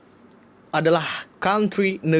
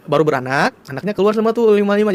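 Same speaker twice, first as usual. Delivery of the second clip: ordinary delivery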